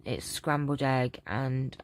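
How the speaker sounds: ordinary talk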